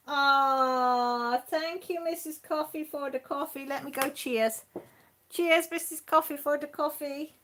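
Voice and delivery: high, bright pretend toast to the coffee; playful high-pitched cheers to the coffee. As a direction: raise the voice high and toast the mug.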